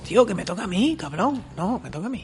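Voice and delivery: high-pitched whiny protest; play-acted indignant bickering; mock squabbling. Imagined squabble over whose turn.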